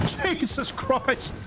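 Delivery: high, drawn-out cry of alarm; real panicked oath, slow and high; alarmed exclamation. Real, not acted. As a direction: stretch it out in high-pitched dismay. Still fleeing the stalker.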